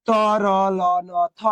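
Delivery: তরল ন থ very slowly, letter by letter; slow chant-like spacing on তরল ন থ; drawn-out listing. Shown as a demonstration. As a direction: stretch each letter of তরল ন থ. Teacher lists the rule's letters.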